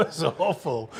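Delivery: сквозь смех, медленно растягивая; real laugh over the words, slowed down. Real, not acted. Repeats the verdict through laughter.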